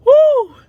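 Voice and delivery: loud, high whoop of relief; relieved whoop. Real, not acted. New mother cheering in relief, high loud whoop. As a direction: let out a cheer.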